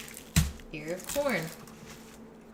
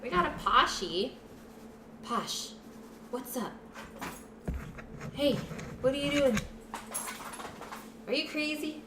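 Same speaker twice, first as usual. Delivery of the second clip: pet talk; 3 semitones higher than usual. Real, not acted. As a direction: coo at the dog. Cooing to the dog.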